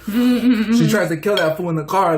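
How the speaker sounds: usual speaking voice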